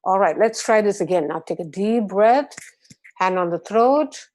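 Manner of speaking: normal talking voice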